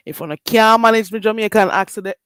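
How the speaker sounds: loud, emphatic Jamaican Patois. Shown as a demonstration. Streamer restating it for accent critics, Jamaican Patois. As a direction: lay on the Jamaican Patois firmly. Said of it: "should I say it in a, another way".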